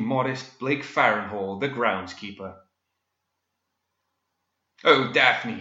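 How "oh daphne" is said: loud, impassioned hero's cry. A narrator's reading. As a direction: cry out her name in melodramatic alarm. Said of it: "he cried".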